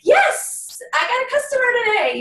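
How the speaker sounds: high, fast burst of joy; thrilled shout of a new sale; excited. Retold or imitated. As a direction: squeal it like you just won. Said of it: "I get excited".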